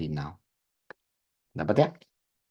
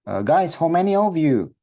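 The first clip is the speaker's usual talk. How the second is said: higher and louder question to a group; calling to the group; 9 semitones higher, 6 dB louder than usual. Acted. Teacher acting someone asking friends, high loud call.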